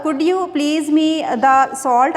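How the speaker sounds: extremely slow exercise read. Shown as a demonstration. Teacher reading the fourth exercise very slowly. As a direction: read each word apart. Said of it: "इसके बाद है आपका fourth".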